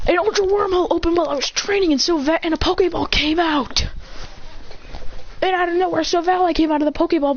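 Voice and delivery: high-pitched hurried explanation under strain; straining to restrain. Acted. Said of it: "he's trying to hold back Silvally".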